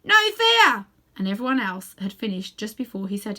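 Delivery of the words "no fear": high, loud perky jackdaw cry. A narrator's reading. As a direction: squawk it out cheekily. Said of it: "added in a loud voice".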